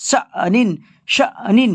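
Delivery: শা নিন with a short catch after শা; correct jerk reading. Shown as a demonstration. Teacher repeating শা নিন with the jolt for students. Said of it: "এইভাবে".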